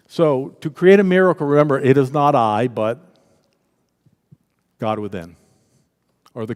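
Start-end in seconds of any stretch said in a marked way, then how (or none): none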